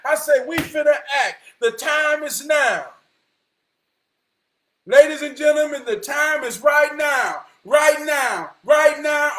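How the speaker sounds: loud, high preacher's proclamation, stretched line endings; fired-up preaching. Acted. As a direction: proclaim it to the whole room at full force. Preaches at full force.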